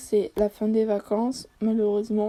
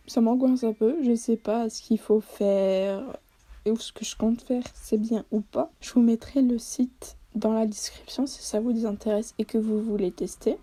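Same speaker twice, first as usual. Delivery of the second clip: ordinary talk, nothing marked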